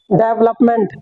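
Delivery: "डेवलपमेंट" as DEV-lup-ment; common mispronunciation. Shown as a demonstration. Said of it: "लेकिन हम पढ़ते क्या है". ("डेवलपमेंट" को डेव-लप-मेंट)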